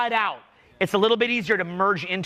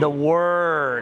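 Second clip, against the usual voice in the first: drawn out; 4 semitones lower than usual. Shown as a demonstration. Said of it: "do this with me".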